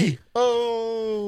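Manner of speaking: high drawn-out yell of the name; cartoon announcer shout. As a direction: belt it like an anime intro. Mocking exclamation of the name.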